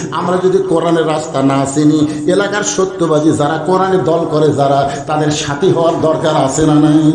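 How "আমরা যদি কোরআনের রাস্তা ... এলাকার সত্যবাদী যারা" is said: ordinary delivery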